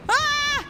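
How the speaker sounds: high-pitched wail of alarm; frightened cry. Real, not acted. Killer chasing.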